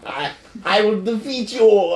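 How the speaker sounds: hammed-up villain voice, pitched high; Red Skull. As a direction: declaim it like a cartoon villain. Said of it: "Red Skull has some lines like".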